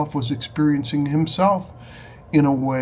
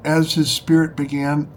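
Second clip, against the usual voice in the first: normal talking voice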